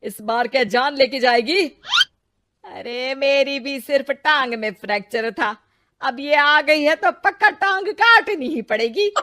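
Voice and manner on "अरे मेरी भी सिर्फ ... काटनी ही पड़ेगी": sarcastic jeer with a laugh; mocking laugh. Father-in-law jeering at Ritu, laughing. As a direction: laugh scornfully at her bad luck.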